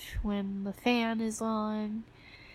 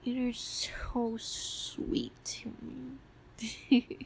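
slow tender whisper; 6 dB quieter, much slower than usual. Acted. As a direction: whisper it, very slowly.

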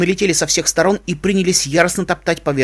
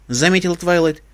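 ordinary delivery

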